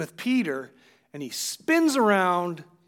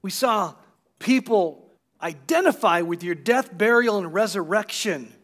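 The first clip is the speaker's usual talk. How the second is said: fervent, louder closing prayer of thanks; a pastor giving thanks for the baptisms; 2 semitones higher, 5 dB louder than usual. Acted. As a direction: lift it up to God with gratitude.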